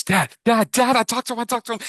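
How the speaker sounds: put-on high squealing kid, rising with joy; thrilled kid. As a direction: tell dad the big news, giddy. Kid thrilled after meeting hero.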